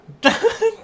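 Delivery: high, loud laugh; burst of laughter. Real, not acted. Player amused by the companion's answer, high loud laugh.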